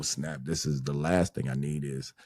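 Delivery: ordinary talk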